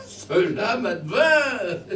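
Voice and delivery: drawn-out sheepish laugh; laughing confession. Acted. Soul admitting heavy drinking, laughing. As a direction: confess with a chuckle.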